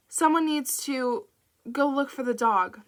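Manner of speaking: breaking off to catch breath between words; gasping it out in short bursts. Acted. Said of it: "She had to stop every few words to catch her breath".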